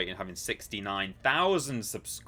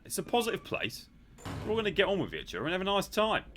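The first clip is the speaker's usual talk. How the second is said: high-pitched put-on voice; Simon Miller; 10 semitones higher, faster than usual. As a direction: pitch it way up, hammed-up positivity. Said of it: "can we request Simon Miller?".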